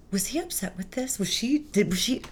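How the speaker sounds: whispered second-guessing; anxious whisper. Retold or imitated. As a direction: whisper the worries to yourself. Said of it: "we both start going".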